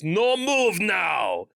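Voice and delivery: slow booming Russian robot shout; booming Russian accent. Retold or imitated. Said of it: "booming Russian voice comes from robot".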